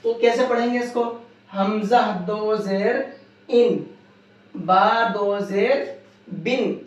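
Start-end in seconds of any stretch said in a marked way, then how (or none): 1.6-6.9 slow: ان، بن with the ن sounded; slow drill for students; slow tanween drill. Shown as a demonstration. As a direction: spell it out slowly, ending on ن. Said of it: "سب میں نون آنا ہے".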